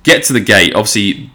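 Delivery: ordinary talk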